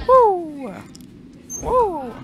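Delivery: excited whoop. Real, not acted. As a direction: cheer it out. Cheering in game.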